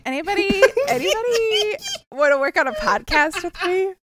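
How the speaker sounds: high, whiny laughing cry for help; mock desperate plea. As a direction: beg dramatically for rescue. Mock plea for new cohost.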